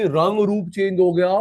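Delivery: normal talking voice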